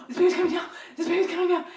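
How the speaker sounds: high, loud, laughing mock panic; husband echoing in panic, laughing imitation; panicked husband. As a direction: imitate his frantic alarm with a laugh. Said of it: "And he's just like".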